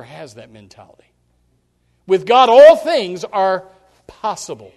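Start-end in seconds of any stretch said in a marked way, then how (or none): 2.1-4.7 proclaiming. Acted. Preacher proclaims key verse.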